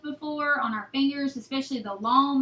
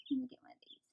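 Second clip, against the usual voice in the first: quick whisper to herself; softer, whispered side remark; whispered aside; 10 dB quieter, much faster than usual. Real, not acted. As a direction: drop to a whisper mid-demo. Aside while handling chicks.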